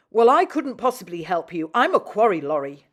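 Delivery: loud, haughty lorry voice; self-important. A narrator's reading. Lorry refusing Rusty, loud and haughty. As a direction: puff up with importance. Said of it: "said Elizabeth importantly".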